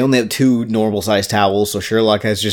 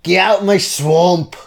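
slow, hammed-up Scottish ogre line; drawn-out comic Scottish; mock Scottish accent; 5 semitones higher than usual. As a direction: order them off in a broad fake Scottish accent. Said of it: "your swamp accent".

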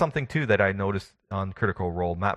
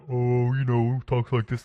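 dopey, higher buffoon voice; bumbling fool; 4 semitones higher, 3 dB louder than usual. Shown as a demonstration. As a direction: play it like a dim-witted buffoon. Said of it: "so the kind of the buffoon voice".

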